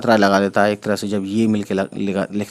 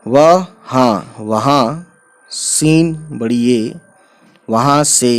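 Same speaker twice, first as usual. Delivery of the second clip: slow beginner's read-aloud, word by word; drawn-out syllables for new learners. Shown as a demonstration. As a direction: spell and read slowly, with long pauses. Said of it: "मैं इतना slow इसलिए पढ़ रहा हूँ".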